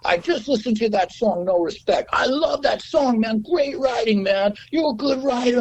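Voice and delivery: high, eager schmoozer voice; gushing record guy. Retold or imitated. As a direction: gush at him like a slick industry guy. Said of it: "He goes".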